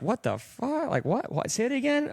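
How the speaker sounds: high, softish puzzled questions; mock bafflement. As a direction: act out someone straining to understand, pitch rising. Acting out a baffled listener.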